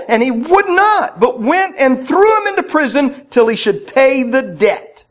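loud, rising preached reading; fervent scripture reading. Real, not acted. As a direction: read the parable out with fire. Reads Matthew aloud fervently.

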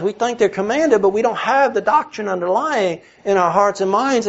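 high, loud, fervent preaching of Paul's words; preaching at full force. Acted. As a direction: drive the scripture home, pulpit-strong.

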